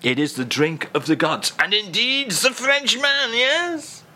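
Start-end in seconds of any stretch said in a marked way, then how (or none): 1.6-4.0 much higher, squeaky mock French; hammed-up high French flourish. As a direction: tilt up into a silly question.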